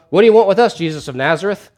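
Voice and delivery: loud, high challenge, easing at the end; calling Jesus out. Acted. Demon in a staring contest with Jesus, challenging. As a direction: confront him as in a showdown. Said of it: "trying to call Jesus out".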